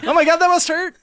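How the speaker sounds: high, loud shocked exclaim; high excited. Real, not acted. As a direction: shriek in sympathy. Reaction, high pitch.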